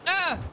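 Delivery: high, sudden "ah" yelp; startled yelp. Real, not acted. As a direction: yelp as if nearly hit. Startled by near hit in game.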